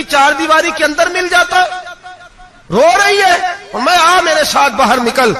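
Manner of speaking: high, forceful retold rebuke; indignant wrath. Caliph rebuking his weeping wife for ingratitude, wrathful. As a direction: scold her in rising outrage. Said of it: "حضرت عمر جلال میں آ گئے".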